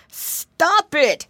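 high, loud plea; snail begging. A narrator's reading. Dizzy snail begging the piggy boy, high loud plea. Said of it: "he begged".

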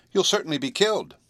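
high-pitched, anxious warning; distressed warning. A narrator's reading. Girl warning her kitten off the air, distressed. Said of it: "she called in distress".

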